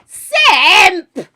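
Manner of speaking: loud, high shout of a name; excited call-out. Real, not acted. Streamer hailing a viewer, excited shout. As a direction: holler it out in one burst.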